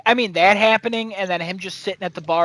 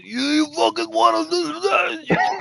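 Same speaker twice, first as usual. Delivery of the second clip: mock angry shout; 10 semitones higher than usual. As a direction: bark it like picking a fight. [name] squares up to Sophia's man.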